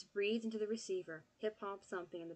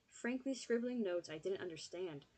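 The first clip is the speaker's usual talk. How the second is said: ordinary delivery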